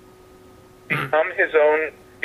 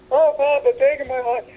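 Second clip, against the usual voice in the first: pleading crowd; 12 semitones higher than usual. Retold or imitated. Crowd voiced in a joke.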